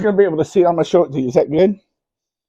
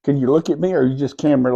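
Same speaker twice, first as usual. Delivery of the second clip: ordinary talk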